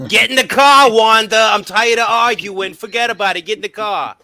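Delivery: hammed-up Brooklyn husband shouting at his wife; Brooklyn tough guy. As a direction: yell at Wanda like a fed-up Brooklyn husband. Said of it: "In Brooklyn".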